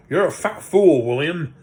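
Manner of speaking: loud, insulting troll shout; a troll yelling at his mate; angry troll. Acted. Trolls quarrel.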